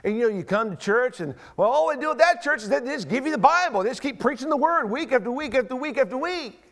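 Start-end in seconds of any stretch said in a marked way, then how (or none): 1.6-6.6 higher-pitched, singsong grumbling; mock complaint. Churchgoer griping about weekly preaching, mock exasperation. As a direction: rattle off the repetition like a fed-up churchgoer.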